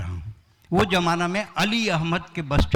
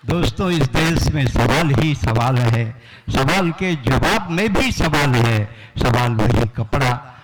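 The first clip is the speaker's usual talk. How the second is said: solemn host; 6 semitones lower, 3 dB louder than usual. Pretend. Host's solemn closing line.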